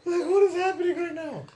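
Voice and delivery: high, loud, cracking up; laughing disbelief. Retold or imitated. Friend recalling the bizarre outburst, laughing in disbelief. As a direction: squeal it through helpless laughter. Laughing at his own demon impression.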